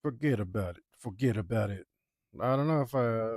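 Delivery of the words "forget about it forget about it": New York Forget about it, as foh-ged-a-bout-it with a flapped t; New York accent. Shown as a demonstration. Practising his Predator character's New York accent. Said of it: "grow into my character's accent".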